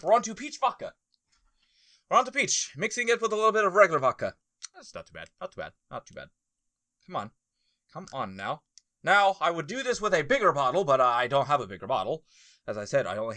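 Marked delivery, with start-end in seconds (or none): 4.7-8.6 much softer, murmured under the breath; quiet grudging approval; hushed. Real, not acted. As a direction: say it half to yourself. Muttering after drinking.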